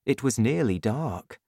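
plain reading voice

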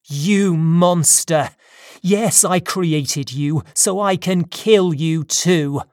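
loud, drawn-out furious accusation; angry cry. Acted. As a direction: cry out in anger at a monster. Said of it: "I cried".

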